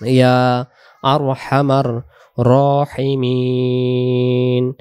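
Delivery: slow, careful Quran-style recitation. Shown as a demonstration. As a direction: recite yeah আরহামার রাহিমিন slowly. Recites dua slowly. (ধীরে দোয়া তিলাওয়াত)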